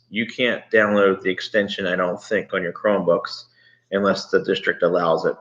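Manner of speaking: ordinary talk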